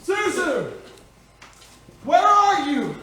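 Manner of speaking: high, drawn calls; yelling out. Acted. Father searches the abandoned house for his children, yelling. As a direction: call loudly through an empty house. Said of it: "What's he yelling for?".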